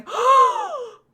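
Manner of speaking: loud, high shocked gasp; imitated crowd gasp. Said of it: "all of the people behind her going".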